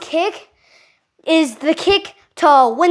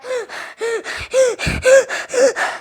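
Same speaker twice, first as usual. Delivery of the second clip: out of breath gasp; 9 semitones higher than usual. Shown as a demonstration. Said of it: "you don't wanna key up so loud that you're like".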